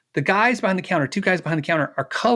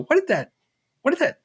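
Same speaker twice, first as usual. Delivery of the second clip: incredulous question; 11 semitones higher, faster than usual. Retold or imitated. Asking wife about blouse price.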